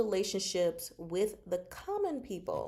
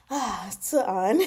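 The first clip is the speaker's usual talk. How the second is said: 1 redone line; 6 dB louder, slower than usual. Retold or imitated. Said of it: "went back and recorded".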